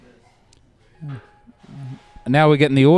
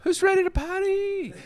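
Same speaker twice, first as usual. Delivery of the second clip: higher, half-laughed hype call; laughing party shout; 6 dB quieter than usual. Acted. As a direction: crack up while hyping the crowd.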